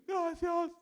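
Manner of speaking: high, loud mock-tearful child; crying child, imitated. Crying boy thanks the young men, high mock wail. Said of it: "Estaba llorando".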